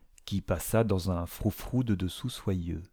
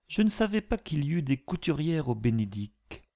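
high sugary insinuating voice; honeyed sly tone; 7 semitones higher than usual. A narrator's reading. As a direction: sweetly needle her. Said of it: "d'une voix mielleuse".

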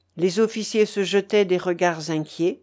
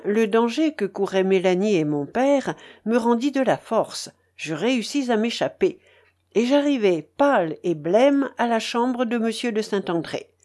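ordinary delivery, nothing marked

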